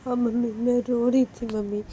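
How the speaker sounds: teary pleading. Acted. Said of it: "Manisha फिर से रोने लगती है".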